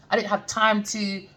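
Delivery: ordinary talk, nothing marked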